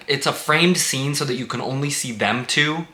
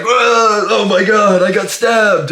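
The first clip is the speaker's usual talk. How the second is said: much higher and louder hammed-up alarm; mock shocked yelp; 11 semitones higher, 9 dB louder, slower than usual. Mocking the stabbed boyfriend's line, loud hammed-up shock. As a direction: overplay a guy who just noticed a knife. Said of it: "And he's like".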